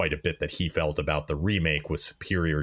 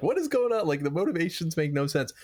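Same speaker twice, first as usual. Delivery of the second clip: very high, incredulous squeal of confusion; mock bafflement; 12 semitones higher than usual. Podcaster echoing co-host's complaints about weird motivations, mock bafflement. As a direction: imitate a baffled viewer, pitched way up. Voices a baffled viewer's reaction.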